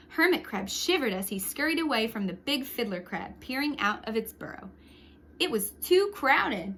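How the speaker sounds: put-on lively read-aloud for children; storyteller voice for kids; story narration. A narrator's reading. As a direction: tell it like a picture book to a class. Reads picture book aloud.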